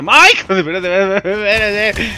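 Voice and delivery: sudden scared shout, pitch jumping up; startled yelp. Real, not acted. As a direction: yelp in real fright and beg it to wait. Startled by monster attack.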